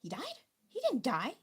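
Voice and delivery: retold shock at a death notice; shocked disbelief. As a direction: refuse to believe the news. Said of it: "I was like".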